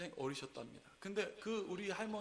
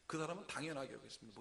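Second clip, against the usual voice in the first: usual speaking voice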